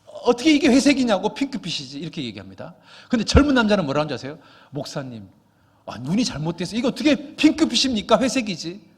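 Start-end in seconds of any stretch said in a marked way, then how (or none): 0.1-2.0 loud, higher burst that drops at the end; half-acted outraged young woman; indignant protest. Young woman protesting to the preacher, mock indignant. 4.7-8.9 louder, higher insistence; half-acted young man arguing; incredulous objection. Young man objecting to the pastor, mock incredulous.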